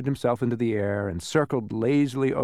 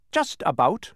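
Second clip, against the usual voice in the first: higher voice, touch of pride; hint of pride; 7 semitones higher, 4 dB louder, slower than usual. A narrator's reading. Bee answers admiring Milo, a hint of pride. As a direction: let slight pride show. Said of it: "with a hint of pride in his voice".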